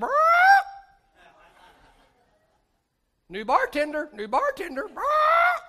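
squawking parrot voice; loud squawky bird impression. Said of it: "the parrot said".

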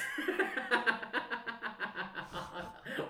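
real high giggling fit; long, uncontrollable guilty laugh; helpless laughter. Real, not acted. Storyteller recalling his brother's fall onto rocks, helpless laughter. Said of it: "I don't know why I laugh".

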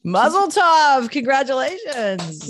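bright, slow and celebratory; excited congratulations. Real, not acted. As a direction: cheer it out warmly. Congratulating two years sober.

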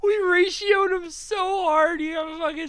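high, loud, slow words through laughter; cry-laughing. Real, not acted. Telling friends the guy got suspended, cry-laughing high and loud. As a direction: squeal it out while laughing. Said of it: "cry-laughing over the story".

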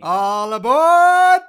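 high, very loud, slow All aboard; loud conductor call. Pretend. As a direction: holler it across the platform. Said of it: "Do it one more time".

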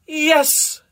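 high, loud cry of pleasure. A narrator's reading. Carmina at peak synchronization, crying out. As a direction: cry it out high, acted ecstasy. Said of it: "cried Carmina".

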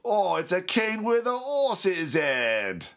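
a dim sidekick marvelling, drawn out. Acted. Mimicking the second in command, slow and high. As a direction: stretch it out high and dopey. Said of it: "he's like".

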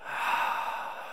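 long unvoiced exhale; slow audible sigh of release; deep breath out. Real, not acted. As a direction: let out a big deep breath. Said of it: "take a deep breath together".

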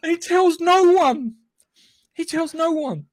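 high, slow, emphatic punchline; amused disbelief. Real, not acted. Telling friends the wedding gag, amused disbelief. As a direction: hit it high, relishing the absurdity.

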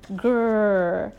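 drawn-out pretend growl; playful dinosaur growl. A narrator's reading. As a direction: growl like a toddler playing dinosaur.

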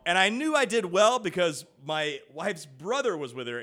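ordinary talk

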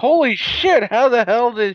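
genuinely floored by the news; shocked. Real, not acted. Host reacting to friend's PCP story, stunned.

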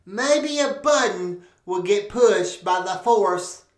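ordinary talk

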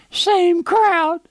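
very high, loud parrot squawk; piercing mimicked bird voice; parrot impression. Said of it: "the old parrot got out".

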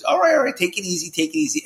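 high calming voice that settles down; soothing someone wound up, mock; imitating Tony. Tony calming the wound-up speaker, high mock soothing. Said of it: "And I heard Tony, he goes".

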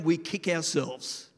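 normal talking voice

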